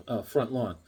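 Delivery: normal talking voice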